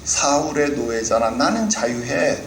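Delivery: Goliath jeering at the Israelites, mocking. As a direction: gloat over the slaves of Saul. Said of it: "그가 조롱하는 소리가".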